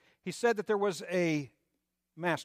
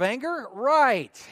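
sarcastic right; 9 semitones higher, 7 dB louder, much slower than usual. Acted. Sarcastic reply to scripture command.

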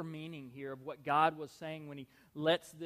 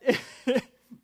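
laugh; 8 semitones higher, 12 dB louder than usual. Real, not acted. Laughing after own shout.

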